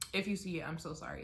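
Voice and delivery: usual speaking voice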